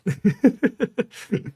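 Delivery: loud high burst of laughter; amused falling laugh; real laugh. Real, not acted. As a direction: laugh hard at your own joke. Laughs after insulting the viewer.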